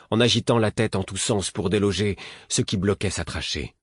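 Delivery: plain reading voice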